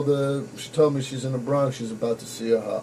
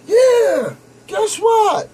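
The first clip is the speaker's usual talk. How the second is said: very high, loud mock girlfriend voice; girlfriend's voice; 8 dB louder, much slower than usual. Girlfriend teasing him on the phone, high squeaky mock voice. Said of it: "She was like".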